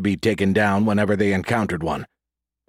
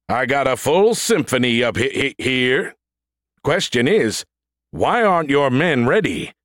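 a touch higher, brash robot trooper; swaggering combat-suit AI voice; cocky android soldier; 4 semitones higher than usual. A narrator's reading. Android Brody mocking the lieutenant.

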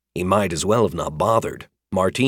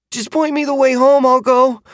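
put-on high, louder pleading boy; 6 dB louder than usual. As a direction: beg to be let go.

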